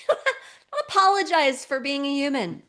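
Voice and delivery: loud, high, playful exasperation; emphatic exhortation. Real, not acted. Exasperated exhortation to those women.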